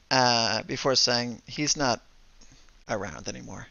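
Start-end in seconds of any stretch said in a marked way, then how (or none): 1.5-3.7 soft, subdued words after a pause; quiet. Acted. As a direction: let it drop quietly, heavy news. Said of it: "is quiet for a moment".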